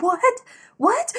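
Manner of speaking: high, loud, rising questions; baffled alarm. Retold or imitated. Cousin failing to understand the sobbing caller, baffled alarm.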